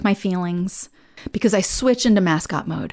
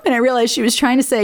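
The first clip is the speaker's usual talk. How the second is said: usual speaking voice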